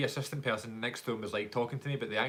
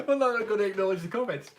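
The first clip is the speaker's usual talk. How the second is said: laughing; 10 semitones higher, 5 dB louder than usual. Real, not acted. Laughing off a chat user.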